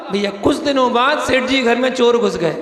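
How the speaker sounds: usual speaking voice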